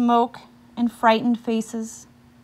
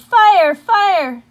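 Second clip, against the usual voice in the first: higher, louder cry of "Fire"; shouted; 9 semitones higher, 10 dB louder than usual. Acted. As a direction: call out "Fire" in alarm. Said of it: "people shouted".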